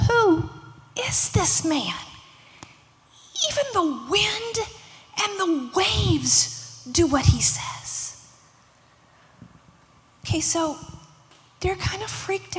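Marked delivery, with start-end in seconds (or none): 0.1-2.0 higher, drawn-out disbelief; astonished question, each word weighed; amazed disciples. Acted. Awed disciples after the storm stills. 3.5-8.2 high, drawn-out amazement; astonished, stretching the key words; amazed disciples. Acted. Awed disciples after the storm stills.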